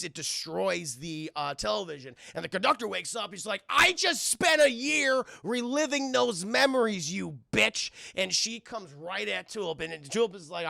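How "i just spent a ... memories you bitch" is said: furious accusation. Acted. Conductor furious at Tulip.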